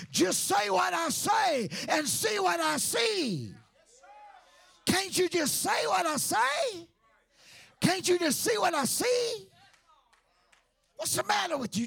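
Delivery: booming divine rebuke; God's loud rebuke. Retold or imitated. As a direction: call him out like a frustrated father. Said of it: "is to get Jeremiah".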